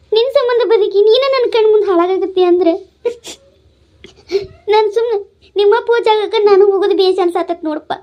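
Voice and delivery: grief-stricken mother talking through tears; tearful confession of despair; crying. Acted. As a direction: sob out the reproach to your son. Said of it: "ನೀ ಅಳ್ಬ್ಯಾಡ್ ಬೇ".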